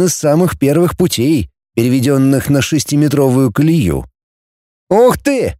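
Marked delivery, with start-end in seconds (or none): 4.9-5.5 high admiring exclamation; delighted. A narrator's reading. Kutnov sees the huge railway track, delighted. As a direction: gasp it out in delight, pitched up. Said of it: "восхитился Кутнов".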